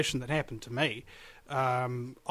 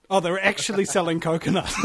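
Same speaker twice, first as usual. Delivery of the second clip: ordinary delivery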